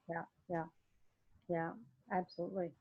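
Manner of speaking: normal talking voice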